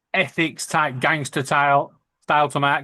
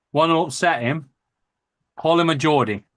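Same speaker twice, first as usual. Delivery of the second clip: usual speaking voice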